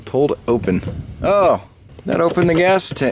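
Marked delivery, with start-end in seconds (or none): 1.2-3.1 high-pitched sudden realization; real surprise. Real, not acted. Lever unexpectedly opens gas door, real surprise. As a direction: jump up in pitch, caught off guard.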